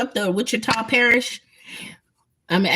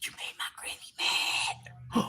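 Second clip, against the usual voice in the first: hushed, slow frightened whisper; scared whisper; 14 dB quieter, slower than usual. Retold or imitated. Kids scared that granny is angry, slow hushed whisper. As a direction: whisper it like a kid in trouble. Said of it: "we was like".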